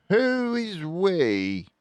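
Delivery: curious Fox voice. A narrator's reading. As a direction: ask it slowly, puzzled. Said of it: "asked Mister Fox".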